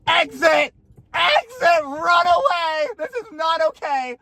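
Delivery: high urgent mock shout; drawn-out, high-pitched alarm call. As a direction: yell it like an emergency warning. Alarm urging herself to flee.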